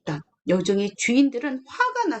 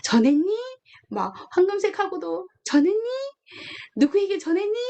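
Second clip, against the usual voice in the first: pestering preacher; 6 semitones higher than usual. Retold or imitated. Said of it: "전도사님이 요즘에 자꾸".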